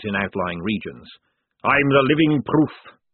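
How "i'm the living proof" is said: loud, booming fat sergeant; hearty sergeant. Acted. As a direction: boom it like a jovial recruiter.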